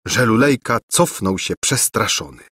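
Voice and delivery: ordinary delivery, nothing marked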